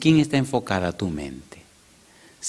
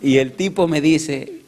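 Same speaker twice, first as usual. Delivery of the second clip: normal talking voice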